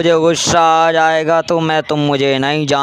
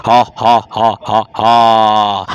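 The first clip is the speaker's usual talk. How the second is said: witch cackle; 2 semitones lower, slower than usual. Acted.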